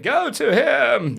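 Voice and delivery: high-pitched, hammed-up urging; mock-dramatic order from bed; exaggerated wife's command. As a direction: put on a dramatic high voice for the wife. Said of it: "she didn't say it that way".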